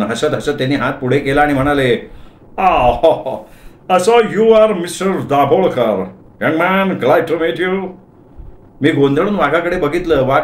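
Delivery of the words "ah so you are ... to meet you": put-on higher-pitched, drawn-out old gentleman's greeting; elderly Parsi gentleman. Elderly Parsi visitor greeting the supposed branch officer, effusive old-gentleman voice. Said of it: "एक वृद्ध पारशी ग्रहस्थ आत शिरले".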